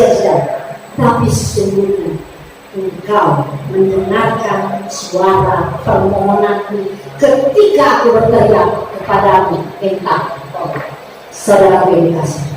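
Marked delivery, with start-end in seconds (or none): 0.9-10.9 slow, soft scripture reading. Acted. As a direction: lower and softer, drawn out. Reading psalm slowly. (membaca ayat pelan dan lembut)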